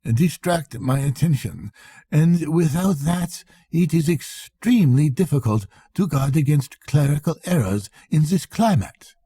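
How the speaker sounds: a touch of fussy irritation; gentle annoyance. Acted. Accountant complaining about the sick man, mild annoyance. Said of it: "he exhibited a gentle annoyance".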